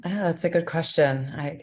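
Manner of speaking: ordinary talk, nothing marked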